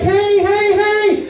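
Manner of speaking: long, very high warning holler; shrill-pitched mock road yell; high-pitched "hey" yell. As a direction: holler it high at another driver. Repeated yells at inattentive driver.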